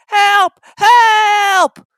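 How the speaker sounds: very high, loud desperate cries; cry for help. A narrator's reading. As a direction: wail for help. James still whistling.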